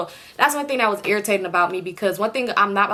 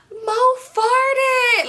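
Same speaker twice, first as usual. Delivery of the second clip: very high, loud squeal; squealing kid voice; 4 dB louder than usual. Retold or imitated. Little sisters shrieking to the boyfriend in the background, high and loud.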